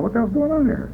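high, soft, baffled squeak; bewildered inner voice. Retold or imitated. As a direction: wonder to yourself in a small high voice. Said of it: "I kept saying to myself".

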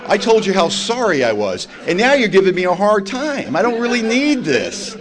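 indignant, rising to a high, loud righteous rant; defiant outburst. Retold or imitated. To brother-in-law Larry, over the car, indignant shout. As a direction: get in his face, offended that he doubts you. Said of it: "So I got right in his face".

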